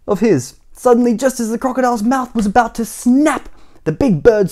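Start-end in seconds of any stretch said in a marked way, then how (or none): none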